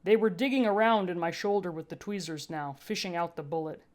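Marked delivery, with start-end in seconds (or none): none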